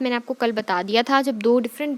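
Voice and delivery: ordinary talk, nothing marked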